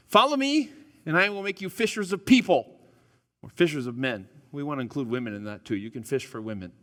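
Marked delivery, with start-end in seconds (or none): 0.1-2.8 higher, louder voice of Jesus calling; quoting Jesus. Acted. Pastor voicing Jesus calling disciples, raised and high. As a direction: call out as Jesus inviting followers. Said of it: "Jesus says this".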